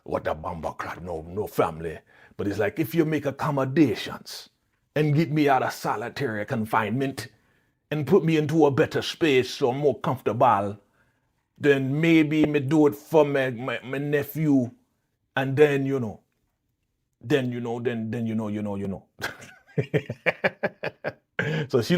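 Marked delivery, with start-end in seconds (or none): 0.0-2.0 low, softer fake Jamaican patois; terrible Jamaican accent. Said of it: "My accent's terrible". 2.8-19.0 bargaining in fake Jamaican patois; terrible Jamaican accent. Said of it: "My accent's terrible".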